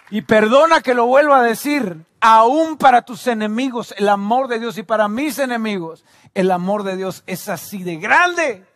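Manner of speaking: fervent pulpit insistence, settling slightly; insistent preaching. Real, not acted. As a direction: press the point on the congregation. Preaching, easing from climax.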